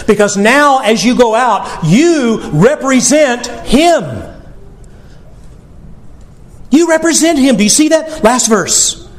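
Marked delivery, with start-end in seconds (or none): 1.8-4.3 drawn out slowly, each word weighted; slow emphatic repeat. Acted. Preacher to congregation, slow and deliberate. 6.7-7.6 pitched up high on YOU; raised emphatic repeat. Acted. Preacher pressing the point, higher and urgent.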